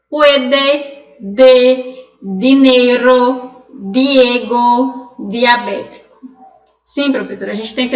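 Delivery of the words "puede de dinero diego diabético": each word said slowly with a clean dental D; Spanish pronunciation. Shown as a demonstration. Teacher reads the word list aloud in Spanish for students. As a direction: read the words one by one with a Spanish D. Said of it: "comparar com o português".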